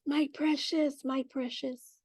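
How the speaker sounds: a lightly voiced Gollum, dropping to a soft hiss; half-hearted Gollum croon over a treasure; Gollum impression. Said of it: "it remind me of the Lord of the Rings".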